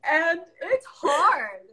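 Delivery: slow, high, choked-up; tearful. Real, not acted. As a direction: let the voice catch. Admitting motherhood is hard.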